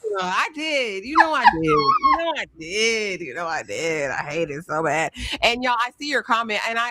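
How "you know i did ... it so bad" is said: shifting, anguished repeated protest; quoted lament. Retold or imitated. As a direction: plead it over and over, voice wavering. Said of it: "I said".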